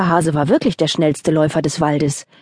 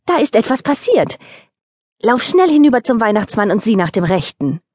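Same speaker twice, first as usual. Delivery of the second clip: impatient wife; 4 semitones higher than usual. Acted. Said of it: "verlor die Häsin die Geduld".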